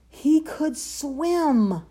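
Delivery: high, loud, drawn-out marvel; delighted discovery. A narrator's reading. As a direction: stretch it out in triumph.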